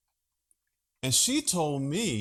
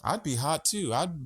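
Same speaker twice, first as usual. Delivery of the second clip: ordinary talk, nothing marked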